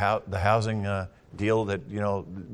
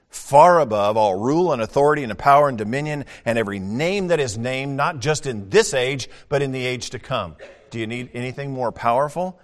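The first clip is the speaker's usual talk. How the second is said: fiery preaching; 2 semitones higher, 8 dB louder than usual. Acted. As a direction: warn the congregation with full force. Preaching at full force.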